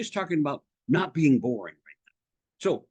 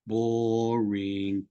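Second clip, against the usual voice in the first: low, long-held drone; bored verdict; 6 semitones lower than usual. Shown as a demonstration. Coach dismissing "tell a story" advice, bored drone. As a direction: stretch the word out in a dull monotone.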